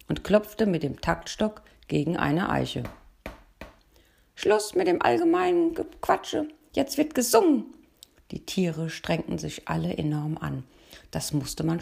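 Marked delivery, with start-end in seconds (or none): 4.3-7.6 shrill high loud order; commanding. A narrator's reading. Nightingale silences the chattering animals, loud and high. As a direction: call the choir to silence. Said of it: "kommandierte die Nachtigall".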